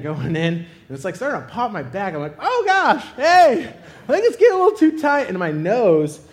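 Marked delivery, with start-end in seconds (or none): 2.4-5.3 high, loud, hurried complaint; mock alarm. Squeezed patient calling to operator, alarmed and loud. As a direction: call out in rising comic panic.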